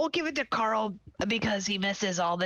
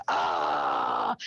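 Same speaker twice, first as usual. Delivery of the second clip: weird scream. Retold or imitated. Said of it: "you know, scream".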